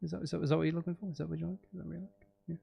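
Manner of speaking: usual speaking voice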